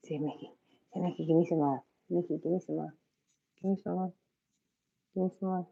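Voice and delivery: softly coaxing the cat, hushed; quiet pleading to a cat. Real, not acted. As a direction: murmur sweetly to a pet.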